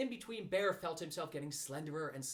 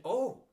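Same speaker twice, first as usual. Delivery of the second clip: straining oh; 8 semitones higher, 11 dB louder than usual. Acted. Being pulled hard.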